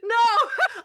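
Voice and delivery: high, laughing no; laughing denial. Retold or imitated. Coach recalling her answer to dad's friend, laughing denial. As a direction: laugh the word out, high-pitched. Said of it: "And I laughed".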